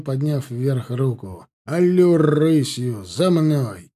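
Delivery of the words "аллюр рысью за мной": loud, slow cavalry order; narrated bellowed marching order; shouted command. A narrator's reading. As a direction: stretch the call out for the riders to follow. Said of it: "крикнул он".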